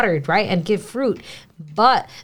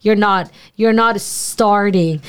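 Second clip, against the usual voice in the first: usual speaking voice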